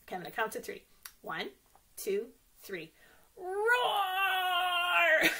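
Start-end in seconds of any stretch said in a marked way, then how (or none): 3.4-5.3 a big playful high roar; lion roar. Pretend. Librarian roars with the children, playful and loud. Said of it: "Should we roar like lions?".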